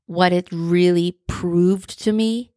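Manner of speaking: ordinary talk, nothing marked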